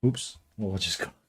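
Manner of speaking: ordinary talk, nothing marked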